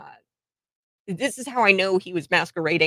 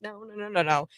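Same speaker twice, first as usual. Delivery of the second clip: usual speaking voice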